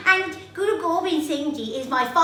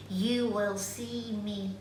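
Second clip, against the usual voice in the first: hushed quoted saying; 7 semitones lower, 9 dB quieter than usual. Retold or imitated. Child quotes the Guru's magical promise, hushed. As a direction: lower it to a hushed, mysterious line.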